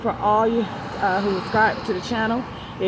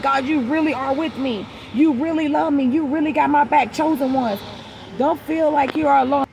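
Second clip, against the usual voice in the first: excited praise; 5 semitones higher than usual. Retold or imitated. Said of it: "I was like".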